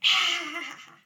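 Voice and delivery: high, loud cackle imitating a child's laugh; mock cackling "Ah!"; imitated cackle. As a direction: mimic her silly cackle with a sudden loud burst. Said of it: "hackle going, like ... when she's laughing".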